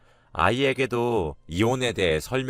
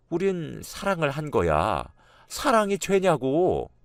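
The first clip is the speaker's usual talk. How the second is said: high, drawn-out indignant cry; raised-voice protest; 8 semitones higher than usual. A narrator's reading. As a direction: raise the pitch into a defiant wail. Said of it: "소리를 지르는데". (목소리를 높여 따지듯 소리치는 말투)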